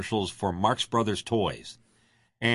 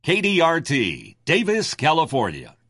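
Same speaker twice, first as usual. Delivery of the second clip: radio voice; 6 semitones higher, 5 dB louder than usual. Shown as a demonstration. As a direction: announce the call letters like on air. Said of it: "give me your voice, your radio voice".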